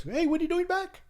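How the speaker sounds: high-pitched call of surprise; imitating a surprised friend, voice way up; retold surprised hail. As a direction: hail someone who has turned up unexpectedly. Retold foreman calling out on return.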